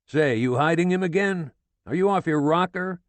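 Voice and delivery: loud, high rough yell; yelled through door. A narrator's reading. Sergeant yelling through the door at the widow, loud. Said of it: "he yelled through the door".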